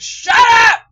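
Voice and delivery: explosive high, very loud yell; sudden shout. Retold or imitated. Speaker yells at the voices in their head, shouting. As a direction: scream it at the noise in your head.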